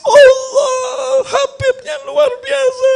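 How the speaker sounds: very high, drawn-out imitation sob; hammed-up tearful wail; mock wailing. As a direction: imitate a congregation weeping in awe. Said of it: "Satu masjid nangis".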